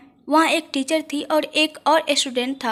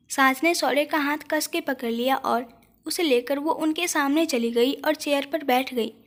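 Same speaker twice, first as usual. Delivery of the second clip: ordinary delivery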